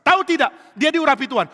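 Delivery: high, very loud blurting; loud, excited-pitched outburst; loud talking. Acted. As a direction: forget everyone is asleep and blurt. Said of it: "Dia ngomong keras".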